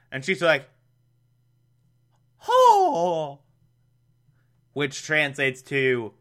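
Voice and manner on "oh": a high falsetto "Oh!"; Aunt Harriet's gasp. Retold or imitated. Podcaster voicing Aunt Harriet's confusion, high falsetto gasp. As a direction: gasp it like a puzzled old aunt.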